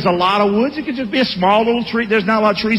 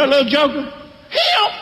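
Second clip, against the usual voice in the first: mocking shout; 4 semitones higher than usual. Townsman pointing out Gideon.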